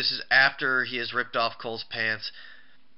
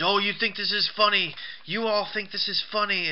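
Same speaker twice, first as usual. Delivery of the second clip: high-pitched indignant imitation; mock indignation; 8 semitones higher than usual. Announcer confronting the laughing wrestler, mock indignant. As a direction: imitate an affronted challenge, pitched well up. Acting out Cole challenging laughing Cena.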